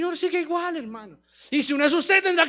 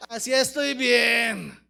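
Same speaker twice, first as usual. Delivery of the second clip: lower, very drawn-out complacent reply; mock complacency; 4 semitones lower, much slower than usual. Paralytic refusing to get up, drawn-out complacency. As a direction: stretch it out slowly, playing content to stay down. Mocking those who refuse to rise.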